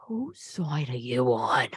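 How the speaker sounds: hushed, slow, whispered challenge; incredulous whisper. Acted. Mom confronts the sales girl, incredulous slow whisper. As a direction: whisper it slowly in disbelief.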